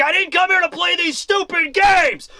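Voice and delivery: high-pitched angry mock shout; crescendo yell. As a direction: act out a fighter yelling at the peak of rage. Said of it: "at the crescendo of Zoro's yell".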